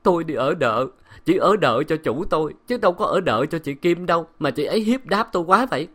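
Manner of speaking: aggrieved, weepy lament; tearful whimpering. A narrator's reading. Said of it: "mếu máo".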